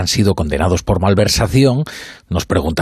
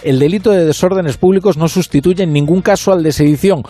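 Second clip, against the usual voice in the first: higher-pitched, emphatic quoted tirade; eager launching in; 7 semitones higher than usual. Retold or imitated. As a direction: reel off the list insistently, imitating an over-eager interviewee. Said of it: "No le deja al entrevistador ni terminar la pregunta y ya se arranca".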